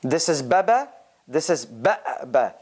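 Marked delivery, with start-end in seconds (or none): none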